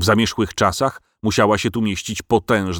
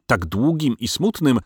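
ordinary delivery, nothing marked

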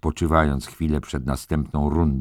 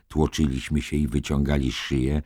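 ordinary delivery, nothing marked

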